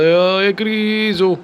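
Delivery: high cheering call; calling out to Griezmann. Real, not acted. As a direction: cheer him on like a fan.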